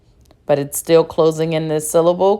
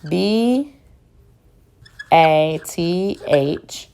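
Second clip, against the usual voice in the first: slow letter spelling; much slower than usual. Shown as a demonstration.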